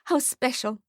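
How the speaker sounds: lightly voiced gushing mother. A narrator's reading. Mother hugs her baby, mildly gushing. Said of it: "she gushes".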